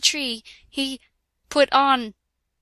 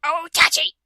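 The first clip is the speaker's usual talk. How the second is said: a mock shout of his name, a bit louder; 4 semitones higher, 4 dB louder than usual. A narrator's reading. Girl reading fanfic shouts Otachi's name, mock loud. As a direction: call out Otachi in hammed-up alarm. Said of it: "I shouted".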